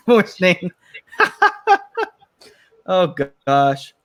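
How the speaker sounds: word laughed out; laughing. Real, not acted. Laughing at a chat comment.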